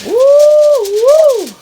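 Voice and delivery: high, loud double woo; delighted whoop. Real, not acted. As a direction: let out two piercing, drawn-out whoops.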